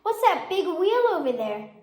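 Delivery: put-on eager child question; bright curious kid. A narrator's reading. Child character asking question.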